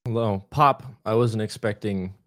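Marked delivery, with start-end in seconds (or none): 0.5-0.8 a short, loud popped word; louder playful pop on request; popping sound. Pretend. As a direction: pop it out like a cork. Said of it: "with a little pop".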